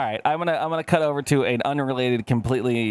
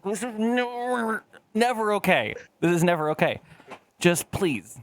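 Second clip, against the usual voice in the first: exasperated plea on never; pleading mock protest; 8 semitones higher than usual. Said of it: "And I'm like".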